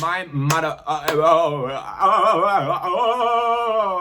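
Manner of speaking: high, loud stunned cries with a laugh; overwhelmed exclamation. Real, not acted. Reacting to the email's insight with high stunned cries. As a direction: gasp out oh again and again.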